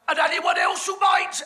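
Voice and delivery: shouted out high and loud; sudden comic yell. Acted. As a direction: bellow it over the crowd. Comic narrator calling over audience.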